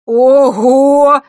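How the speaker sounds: high, very loud whoop; loud amazed exclamation. Acted. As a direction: burst out in astonishment. Exclaims while running after her. (громко, изумлённо)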